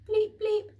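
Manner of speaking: two loud high bleeps; loud high mock keypad beeps; double beep. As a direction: beep twice like a cheerful keypad. Keypad beeps green light.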